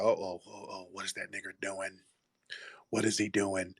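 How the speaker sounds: hushed suspicious coworkers. Retold or imitated. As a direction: murmur it like worried gossip behind a desk. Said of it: "they're like".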